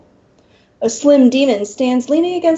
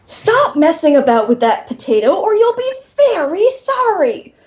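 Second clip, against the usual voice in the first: a genteel demon cracking; mild mock roar; 7 semitones higher than usual. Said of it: "the demon roars".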